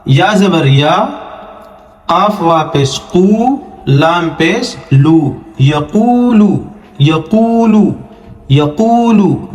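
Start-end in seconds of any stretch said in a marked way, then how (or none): 5.6-9.5 یقولو higher, the waw after قاف drawn out; long waw. Shown as a demonstration. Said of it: "وا مدہ کا ہے، تھوڑا سا کھینچے". (یقولو میں واو کو کھینچیں)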